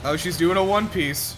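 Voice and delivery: usual speaking voice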